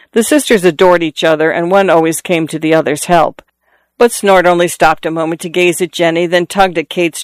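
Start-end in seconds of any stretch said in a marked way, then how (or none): none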